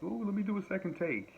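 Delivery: high, prissy mock voice; mock fussy perfectionist. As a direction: sneer at people who redo takes. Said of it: "I'm not one of those people like".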